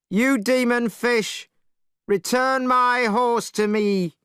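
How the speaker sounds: high, loud slow challenge; Monkey calling the dragon from the bank. Acted. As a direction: bellow it across the water. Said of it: "he called into the water".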